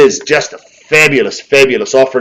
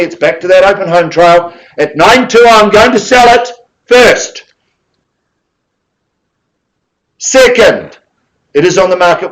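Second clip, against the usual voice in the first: brisk, higher auction call, driving the bids; auctioneer calling; 6 semitones higher, 4 dB louder than usual. Acted. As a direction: rattle off the numbers like a live auctioneer. Auctioneer closing the sale.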